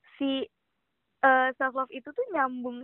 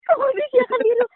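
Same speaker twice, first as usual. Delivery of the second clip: high, loud emphatic repeat; 12 semitones higher, 9 dB louder than usual. Shown as a demonstration. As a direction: shout the phrase out for effect. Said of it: "Ada adik-adik tuh kata-katanya". (ulangi dengan lantang dan bersemangat)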